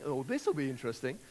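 doubtful tone. Shown as a demonstration. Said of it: "depending on the tone that you have in that voice".

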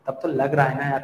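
normal talking voice

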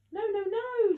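put-on high, loud child's squeal of protest. A narrator's reading. Small boy protesting bedtime to his gran, loud high squeal. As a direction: shriek it like a little boy refusing bed. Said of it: "squealed Maxie".

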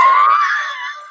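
loud high laugh. Real, not acted. Big laugh at own logo.

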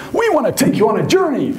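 high, upbeat producer voice. Retold or imitated. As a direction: sell the trip like a keen TV man. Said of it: "He said".

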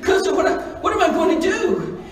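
high-pitched retold distress; upset girl. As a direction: plead helplessly for advice, acting a distraught teen. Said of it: "to cry".

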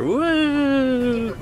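long, high, soft woo; high gleeful whoop, held long; whee-like woo. Real, not acted. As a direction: let out a sliding-downhill woo. Imagining sledding down the hill.

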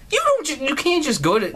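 ordinary talk, nothing marked

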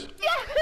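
squeaky high whoop before a shot; high yahoo cry. Real, not acted. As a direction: yelp it up high. Shot-taking ritual cry.

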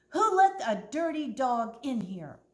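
loud, angry shout; screaming manager. Acted. Manager keeps yelling about the dirty dog, loud angry. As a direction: keep yelling it, outraged. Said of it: "he kept screaming".

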